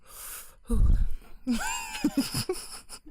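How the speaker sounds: high shrieking burst of laughter. Real, not acted. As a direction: cackle out, high and loud. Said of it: "that was horrible".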